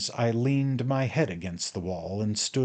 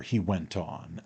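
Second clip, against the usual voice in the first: usual speaking voice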